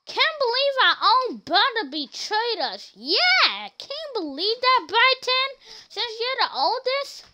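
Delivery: imitating a game character whining about a traitor brother; squeaky betrayed kid. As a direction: mimic a cartoon kid feeling betrayed. Game characters' voiced lines.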